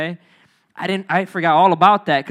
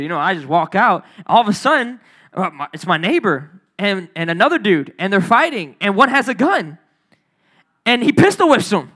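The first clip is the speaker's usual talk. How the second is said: usual speaking voice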